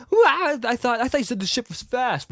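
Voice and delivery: high, loud whiny-kid impression; hammed-up high-pitched outburst; Luke. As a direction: complain in a mock startled high voice. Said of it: "Luke's like".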